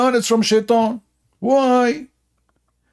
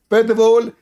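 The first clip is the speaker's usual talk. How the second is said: pitched-up parody of a rival debater; mocking Zakir Naik; 6 semitones higher than usual. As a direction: lampoon a smug preacher, pitched up. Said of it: "Okay, Zakir Naik".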